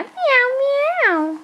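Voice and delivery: high squeaky meow like a kitten; cat meow. Shown as a demonstration. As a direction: mew it high like a little cat. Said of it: "What sound does a cat make".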